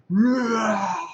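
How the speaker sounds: high, loud drawn-out mock roar; imitated monster roar. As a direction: roar like the bitten beast. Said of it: "He's like".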